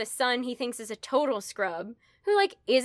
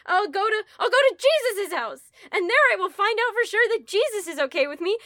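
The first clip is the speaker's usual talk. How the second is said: high, loud, hammed-up self-justifying; mock desperate resolve; 10 semitones higher, 5 dB louder, faster than usual. Guilty Henry plans his crusade, mock desperate. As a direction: talk yourself into it, pitched way up.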